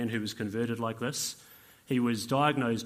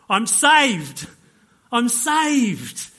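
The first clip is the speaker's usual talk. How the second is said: high, loud cry of joy; overjoyed shout; 8 semitones higher, 11 dB louder, much slower than usual. Acted. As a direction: shout it out, elated. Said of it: "this big smile came in his face".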